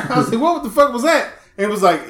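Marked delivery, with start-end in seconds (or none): 0.4-1.3 high-pitched comic outburst; hammed-up startled question; retold bewilderment. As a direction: ask it high, in mock shock. Retells reaction to losing fries.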